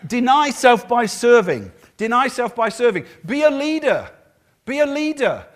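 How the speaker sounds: high, loud preaching call; rousing exhortation. Acted. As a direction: urge the congregation forcefully.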